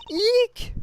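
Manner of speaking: high startled eek; a sudden frightened squeal. Acted.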